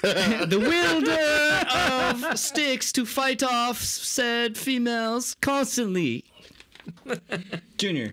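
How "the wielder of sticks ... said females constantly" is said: bad English accent. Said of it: "his English accent need work".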